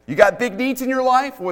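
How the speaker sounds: normal talking voice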